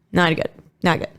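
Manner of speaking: ordinary talk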